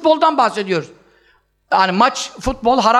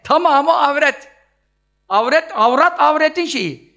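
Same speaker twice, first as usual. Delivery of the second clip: usual speaking voice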